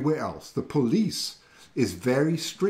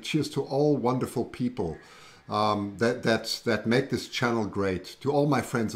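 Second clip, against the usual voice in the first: ordinary delivery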